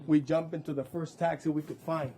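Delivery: ordinary talk, nothing marked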